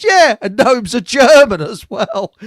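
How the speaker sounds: loud, high, emphatic retelling; retold punchline. Teller quoting the gnomes are German punchline, loud and high. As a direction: belt it out, slightly slowed.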